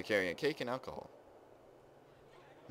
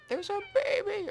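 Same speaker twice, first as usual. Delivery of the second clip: very high, squeaky thrill; real delighted squeal; 4 dB louder than usual. Real, not acted. Player spots a baby kitten, very high delighted squeal. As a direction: squeal over something tiny and adorable.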